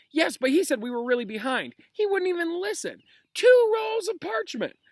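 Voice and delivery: very high, loud indignant squeal; outraged students. Acted. Class protests Snape's essay to Lupin, shrieking. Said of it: "The babble broke out again".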